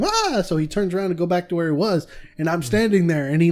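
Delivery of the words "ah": high yelp to scare; mock scare yell. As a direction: jump out and yell "Ah". Scare actor yells at group.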